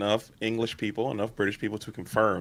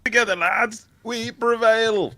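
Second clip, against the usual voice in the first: very high, slow mock heroic cry; rally troops like a hero; mock knight's call; 12 semitones higher, 3 dB louder, slower than usual.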